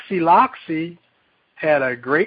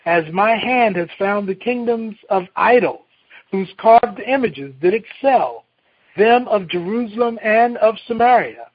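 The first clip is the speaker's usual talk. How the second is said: scripture reading; 4 semitones higher, 3 dB louder than usual. Acted. Reads scripture aloud.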